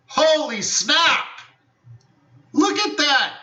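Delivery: gleeful surprise. Real, not acted. Teacher amazed at the target the trade plan hit, in a high delighted cry. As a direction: squeal at the chart like you can't believe it.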